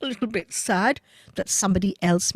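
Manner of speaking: usual speaking voice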